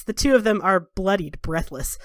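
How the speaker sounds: usual speaking voice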